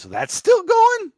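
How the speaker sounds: very high, slow mock disbelief; imitating incredulous co-host. Host quoting Chad on Noctera, exaggerated high-pitched disbelief. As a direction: squeal the question in drawn-out amazement. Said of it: "Chad says".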